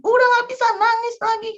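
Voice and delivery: put-on mother telling crying child to stop; scolding a crying child.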